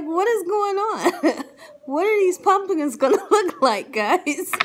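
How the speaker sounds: high, loud, words through laughter; laughing disbelief; cracking up. Real, not acted. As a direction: ask it while laughing helplessly. Laughing at the pumpkin story.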